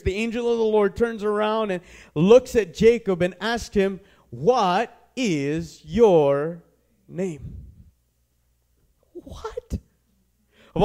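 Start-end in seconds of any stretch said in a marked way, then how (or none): 4.3-7.5 each word drawn out very slowly; slow solemn question. Acted. Angel asks Jacob, drawn out. 9.1-9.8 high, soft disbelieving "what"; can't believe that question; incredulous what. Real, not acted. Preacher reacts to the question.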